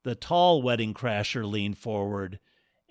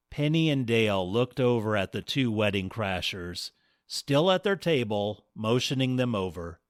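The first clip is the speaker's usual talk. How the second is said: usual speaking voice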